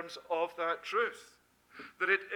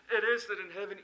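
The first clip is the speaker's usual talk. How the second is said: normal talking voice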